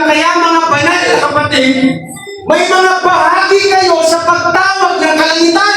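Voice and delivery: high, ringing scripture proclamation; fervent preaching. Real, not acted. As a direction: proclaim it urgently to the congregation. (masiglang pagpapahayag ng salita, mataas ang tono)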